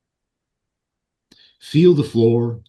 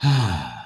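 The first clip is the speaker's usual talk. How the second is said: audible exhale; 4 semitones lower than usual. Shown as a demonstration. Showing listeners the steady breath, audible exhale.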